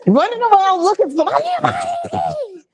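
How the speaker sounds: high, flustered, laughing at the end; frantic search. Acted. Acting out a panicked search for keys, high and flustered. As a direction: play someone scrambling for lost keys.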